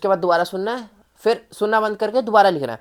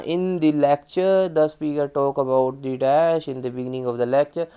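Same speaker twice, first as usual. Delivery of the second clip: slow, dragging and lower; painfully slow demo of bad speaking; too slow; 5 semitones lower, much slower than usual. Shown as a demonstration. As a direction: drag every word out slowly. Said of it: "बहुत slow नहीं बोलना".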